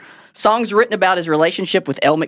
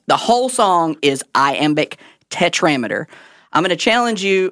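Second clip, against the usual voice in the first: normal talking voice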